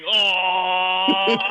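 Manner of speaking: gushing onlooker "aw"; sideline onlookers cooing. Acted. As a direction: coo it loudly like a watching crowd. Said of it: "the people on the sideline just going".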